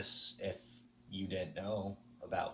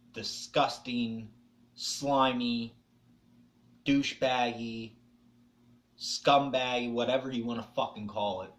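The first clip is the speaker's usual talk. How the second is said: building self-disgust; 6 dB louder than usual. Real, not acted. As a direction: spit each word out at yourself. Listing insults at himself.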